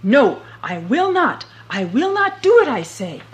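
loud defiant shouting; yelling. Acted. Boots at the king's gate, loud defiant yelling. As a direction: bellow it at the gate. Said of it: "began yelling as loudly as he could".

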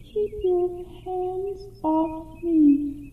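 high, soft, very slow echo; mournful echo. Acted. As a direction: draw it out as a fading echo. Echo repeats the rejection.

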